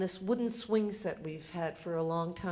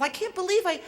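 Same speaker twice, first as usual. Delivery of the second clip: ordinary talk, nothing marked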